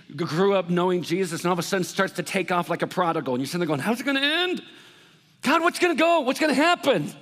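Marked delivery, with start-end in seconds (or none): 3.8-7.1 anxious pleading. Retold or imitated. Worried parent calling out to God.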